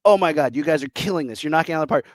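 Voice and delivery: ordinary delivery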